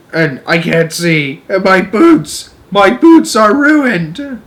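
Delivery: high, loud, slow lament; distressed centipede. A narrator's reading. Centipede lamenting his legs, eyes and boots. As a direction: moan over the ruined boots. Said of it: "screamed with anger".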